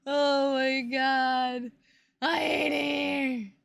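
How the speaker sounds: slow, drawn-out exasperated groan. Real, not acted. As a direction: drag it out in despair.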